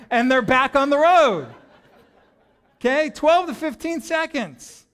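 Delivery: preacher getting wound up over a pit stop; building excitement. Real, not acted. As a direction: pile up the excitement, ending in a laugh.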